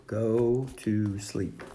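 slow, drawn-out words; writing it out. A narrator's reading. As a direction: say each word slowly as if writing. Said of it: "Toad wrote on the ground".

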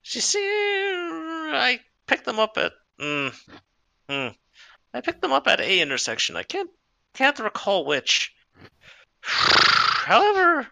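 high, slow, whinnying mare voice; slow, high-pitched horse voice, trying to remember; drawling old horse. Acted. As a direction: stretch the words out like a musing horse. Horse Maxine recalls slowly.

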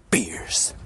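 whisper. Acted. Whispered sign-off word.

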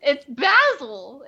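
basil drawn out with a short A; insisting on BAZ-ul. Shown as a demonstration. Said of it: "you say basil".

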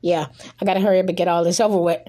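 rushed, fast patter; talking real fast. Real, not acted. Host rushing through podcast plugs, rattling off fast. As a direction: hurry through it to get it over with. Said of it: "I'm talking real fast".

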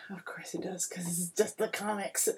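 hushed, dry aside under the breath; whispered aside. Real, not acted. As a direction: drop to a whisper for the joke. Wry aside about comics.

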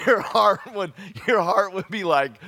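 high and loud, breathless dread; hammed-up racing heart; mock panic. Boy waiting for the whipping, high loud mock fright. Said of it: "heart pounding on dad's step".

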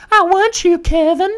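very high falsetto seductive coo. Acted. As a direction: coo it up high, luring him. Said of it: "all seductive like".

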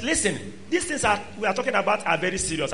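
ordinary delivery

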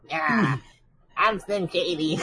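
high, nasal rapper voice. Retold or imitated. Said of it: "high-pitched, nasally voice he had back then".

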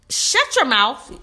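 slow, firm shut-down; sharp comeback. Retold or imitated. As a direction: put him in his place, slow and firm. Snapping back at catcaller.

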